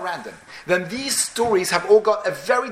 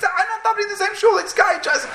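very high, squealing mock outrage; exasperated rant. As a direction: sputter in hammed-up indignation, voice way up high. Acted outrage at latecomer in shul.

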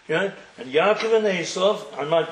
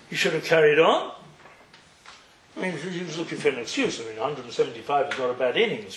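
ordinary talk

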